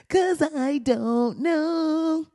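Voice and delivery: high, slow words through laughter; giggly, stretched-out admission; laughing. Real, not acted. Laughing after asking for help.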